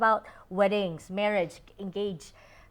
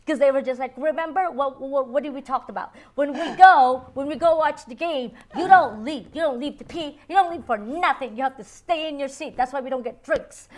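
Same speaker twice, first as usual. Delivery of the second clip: raised, firm British-free scolding voice a touch higher; scolding wife; 6 semitones higher, 7 dB louder than usual. Retold or imitated. Wife scolding husband for leaving the game, firm and raised. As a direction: lay down the rules to her husband.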